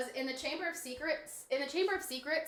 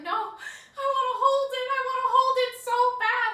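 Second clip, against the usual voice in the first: high, loud, wailing sing-song plea; mock bawling; 12 semitones higher, 10 dB louder than usual. Begging partygoers to let her hold the snake, mock bawling. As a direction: wail it like a tipsy sobbing kid. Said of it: "I'm, like, bawling my eyes out".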